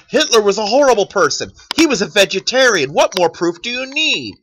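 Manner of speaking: insistent, parodied debater's voice; mock triumphant argument. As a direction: argue it as if it proves everything. Acting out a flawed argument.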